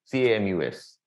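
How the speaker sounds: C A M U S spelled letter by letter; letter-by-letter spelling of Camus. Shown as a demonstration. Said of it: "but write as Camus".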